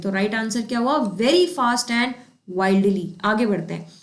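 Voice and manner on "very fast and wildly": very slow, answer laid out word by word; slow answer read. Shown as a demonstration. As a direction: give the right answer slowly to the class. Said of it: "teacher gives the answer". (उत्तर बहुत धीरे बोलें)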